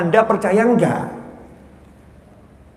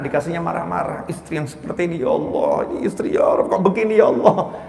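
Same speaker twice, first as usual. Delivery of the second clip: hushed, lower inner grumble; inner complaint; 3 semitones lower, 5 dB quieter than usual. Acted. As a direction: voice a weary husband's silent complaint, softly. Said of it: "di benaknya, di hatinya". (keluhan lirih dalam hati)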